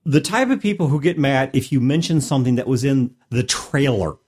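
usual speaking voice

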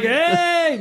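high, drawn-out "hey"; playful high-pitched whoop; mock party holler. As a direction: call it out like a reveler. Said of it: "It's like".

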